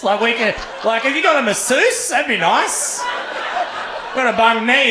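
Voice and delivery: high-pitched comic disbelief; amazed. Retold or imitated. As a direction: act out flabbergasted questions, voice pitched right up. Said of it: "I was amazed".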